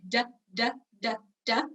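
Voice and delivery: "da" repeated with a soft, voiced d; soft consonant drill. Shown as a demonstration. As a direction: tap out "da" lightly, one beat each. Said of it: "we'll do a soft one".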